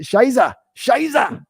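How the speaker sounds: exaggerated German curse; German swear.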